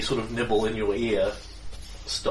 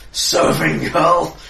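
indignant echo; 5 semitones higher, 9 dB louder, much slower than usual. Acted. Outraged echo of insult.

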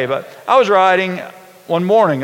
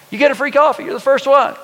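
much higher, a light mock of her excitement; excited church greeter; 7 semitones higher than usual. Church lady offers the biker free coffee, gushing and high. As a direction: play up the greeter's delight at a first visitor. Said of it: "and she's excited".

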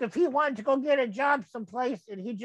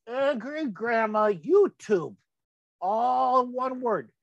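slow dictation; slower than usual. Shown as a demonstration. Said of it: "dictating search term".

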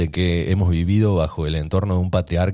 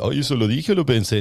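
higher, whiny "Ay, ¿eso lo dije o lo pensé?"; mock a scatterbrained friend; high sing-song mockery of a silly excuse; mocking ditzy voice; 6 semitones higher than usual. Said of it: "está esa frase, ¿viste?".

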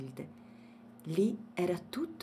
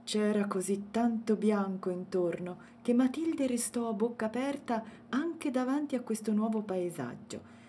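usual speaking voice

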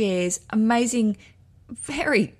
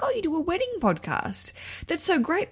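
bright, high imitation of Nada; perky phone agent gushing; chirpy high quote voice; 10 semitones higher, 3 dB quieter than usual. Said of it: "she's like".

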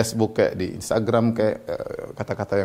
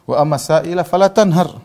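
louder, higher Quran recitation in one run; raised, continuous scripture quote; full verse recitation; 6 semitones higher, 6 dB louder than usual. Acted. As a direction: recite the whole verse out firmly.

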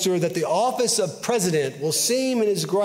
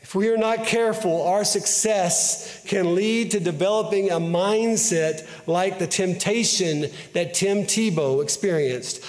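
ordinary delivery

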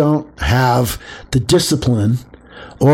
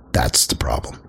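much lower, near whisper; hushed punchline; 11 semitones lower, 5 dB quieter than usual. Real, not acted. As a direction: drop to a confiding whisper. Punchline of his rant.

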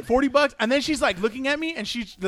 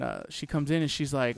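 usual speaking voice

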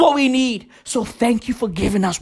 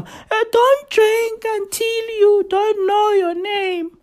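imitating Nkosazana Zuma; 8 semitones higher than usual. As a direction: mimic a stern minister addressing the nation, pitched high. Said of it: "I saw Nkosazana Zuma ... addressing the country".

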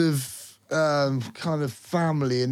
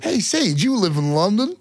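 higher, imitated American asking a question; mock American accent; 6 semitones higher, 4 dB louder than usual. Co-host mimicking Americans who ask about London, American accent. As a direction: put on an American tourist's voice. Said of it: "That's what they all say".

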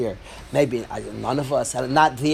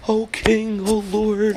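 a higher, frightened plea to the king; trembling plea; 6 semitones higher, slower than usual. Acted. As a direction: beg the king, voice up and afraid. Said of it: "He's shaking".

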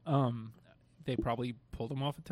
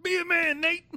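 loud, high Southern holler; Southern accent yelled out; southern drawl; 11 dB louder than usual. Pretend. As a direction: belt it like a good ol' boy. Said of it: "You're getting progressively more southern".

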